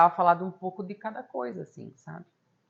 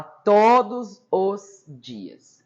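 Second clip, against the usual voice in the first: "todos os dias" loud and drawn out word by word; emphatic "todos os dias"; 5 semitones higher, 11 dB louder, much slower than usual. Real, not acted. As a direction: hammer each word of "todos os dias" slowly. Stressing how often she said it.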